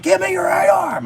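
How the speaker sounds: high shouted order, cop pinning someone; mock police shout. Retelling cops ordering him, high shouted command. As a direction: yell it like the arresting officer.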